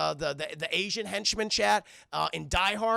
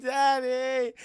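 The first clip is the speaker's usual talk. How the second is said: high, loud imitated wail; mock crying daughter; 6 semitones higher, 5 dB louder than usual. Captive daughter calls to father, mock crying. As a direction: wail like a frightened daughter, hammed up. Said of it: "she's crying and shit".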